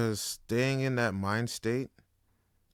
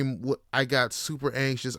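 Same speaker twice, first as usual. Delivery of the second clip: usual speaking voice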